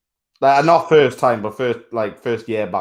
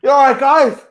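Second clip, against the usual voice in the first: much higher, louder cheesy hello; mock high greeting; 7 dB louder than usual. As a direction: ham up a big grinning greeting. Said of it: "He's gonna".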